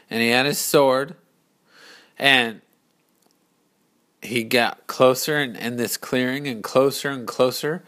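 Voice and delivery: ordinary delivery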